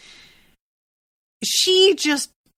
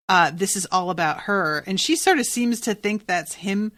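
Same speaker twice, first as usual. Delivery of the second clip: ordinary talk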